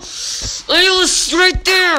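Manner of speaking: high, loud wail of frustration; frustrated yelling. Real, not acted. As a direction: cry out at the screen. Misses the goal in game.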